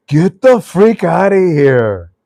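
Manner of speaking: high, loud, drawn-out exclamation; loud disbelief. Real, not acted. Host reacting to the caller's bank story, loud disbelief. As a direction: belt it out slowly in amazed disbelief.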